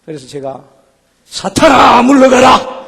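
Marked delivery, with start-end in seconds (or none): 1.2-2.8 loud, higher shout at Satan; fervent exorcism cry; commanding shout. Retold or imitated. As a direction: rebuke the devil at full voice. Casting out Satan.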